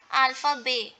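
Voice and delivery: "alpha be" ending on the vowel, no T; silent T. Shown as a demonstration. Teacher explaining the rule, "alpha be" with silent T. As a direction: say "alpha be" and leave the final T silent. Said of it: "Okay, T is not pronounced".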